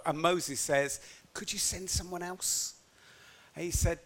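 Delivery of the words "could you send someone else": timid plea. Acted. Moses begging God, timid.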